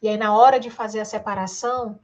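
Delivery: ordinary delivery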